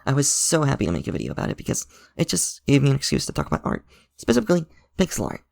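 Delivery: usual speaking voice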